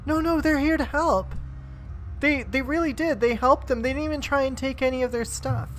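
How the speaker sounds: high, insistent defending voice; pleading halfling. Acted. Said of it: "She's like".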